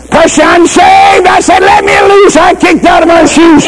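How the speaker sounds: high exultant shout as he runs to the highway; triumphant preaching shout. Retold or imitated. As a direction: ride the joy of the rescue up high. Joyful climax, boy saved.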